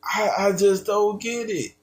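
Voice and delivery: ordinary delivery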